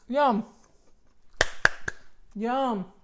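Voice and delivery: talking with mouth full; words around a bite of food. Real, not acted. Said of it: "I'm talking with my mouth o-, open with something".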